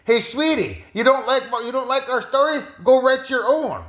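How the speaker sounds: high, sweet and sassy; sassy spirit voice. Retold or imitated. Her spirit telling Camilla to write her own story, high and sassy. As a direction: talk down sweetly as a cheeky spirit. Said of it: "That's your spirit".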